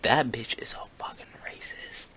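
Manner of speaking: whispered retelling of indignation; hushed verdict. Retold or imitated. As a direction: whisper it like a damning secret. Retold parents' verdict on the principal.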